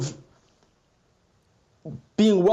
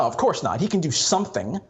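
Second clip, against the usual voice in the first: normal talking voice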